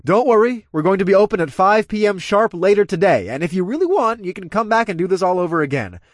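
loud, high, cajoling crowd address; announcing. Acted. Club owner sending drinkers home, announcing loudly.

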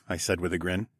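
ordinary delivery, nothing marked

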